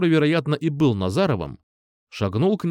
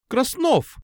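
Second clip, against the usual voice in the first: horrified exclamation; 13 semitones higher than usual. A narrator's reading. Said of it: "с ужасом воскликнула учительница".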